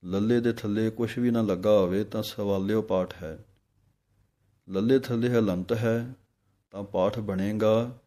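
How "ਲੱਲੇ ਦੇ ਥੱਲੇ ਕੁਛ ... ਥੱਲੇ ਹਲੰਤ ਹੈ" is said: normal talking voice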